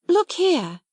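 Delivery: high, eager call; eager cry. A narrator's reading. Girl struck by an idea, crying out eagerly. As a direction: grab her friend's attention. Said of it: "she cried".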